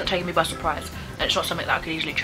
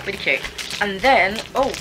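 ordinary delivery

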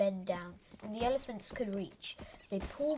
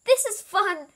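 excited; 12 semitones higher, 11 dB louder than usual. A narrator's reading. Elephant excited at the game.

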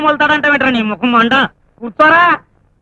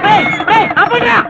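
high-pitched yell to stop; shouting; 10 semitones higher than usual. Real, not acted. Teacher yells to stop.

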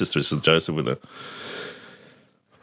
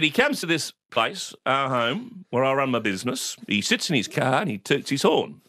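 slightly patronising, higher-pitched explanation; talking down; 7 semitones higher than usual. Retold or imitated. Father explaining to son, talking down. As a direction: spell it out as if to an idiot. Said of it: "he spoke to me like I was an idiot".

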